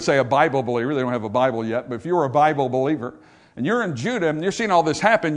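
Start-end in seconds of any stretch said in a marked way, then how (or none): none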